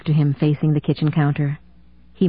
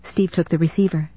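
ordinary delivery, nothing marked

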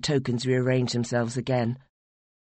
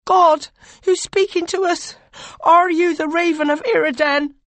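much higher, loud outburst; bursting out; 6 dB louder than usual. Acted. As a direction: unable to hold it in, cry out to the god. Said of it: "You could no longer restrain yourself".

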